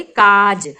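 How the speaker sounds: काज said slowly, the aa after क pulled long and loud; slow teacher's काज with a long open aa; drawn-out vowel. Shown as a demonstration. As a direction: stretch the aa in काज for the children. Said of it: "जो क है उसको हमने काफी खींच कर बोला".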